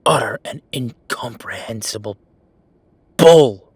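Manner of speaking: loud exasperated swearing, exploding on bull; furious outburst. Acted. Venting to the listener at work, loud furious outburst. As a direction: blow up at the absurd news.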